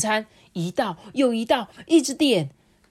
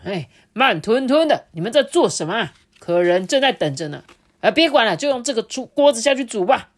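loud, higher impatient scolding; impatient head chef; 4 semitones higher, 7 dB louder than usual. Acted. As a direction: hurry them up.

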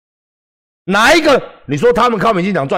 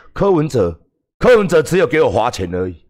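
ordinary talk

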